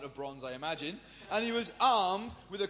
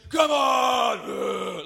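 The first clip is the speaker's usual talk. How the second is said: loud, higher shouted dare; taunting shout; 4 semitones higher, 12 dB louder than usual. Retold or imitated. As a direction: bellow it like a kid picking a fight. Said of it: "He's like".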